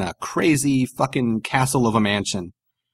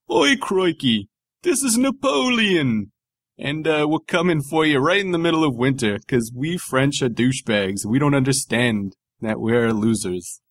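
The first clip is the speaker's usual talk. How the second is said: mock British-Australian accent; 3 semitones higher than usual. Mocking the French as Napoleon, in a British-Australian accent. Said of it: "you made Napoleon sound British and Australian again".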